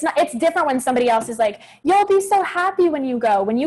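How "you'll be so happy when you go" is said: high, sing-song imitation of a cheerleader; mock encouraging voice. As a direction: chirp it like a pushy friend. Imitating someone else's encouragement.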